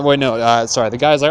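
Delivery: normal talking voice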